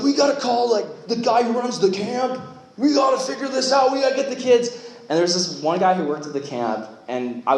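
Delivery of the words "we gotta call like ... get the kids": high, urgent, rushed pleading; panicked urgency. Retold or imitated. Leader retells panicking when kids got lost at camp. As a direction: sound frantic about the missing kids.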